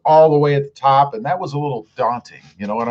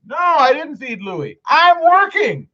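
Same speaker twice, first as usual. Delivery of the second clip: higher, slow, mock-offended protest; mock indignant; 10 semitones higher, slower than usual. Answering his wife's teasing chat, mock indignant and slow. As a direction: play the busy husband, outraged.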